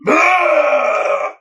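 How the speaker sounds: hammed-up beast cry; wolfman yelp. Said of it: "he's like".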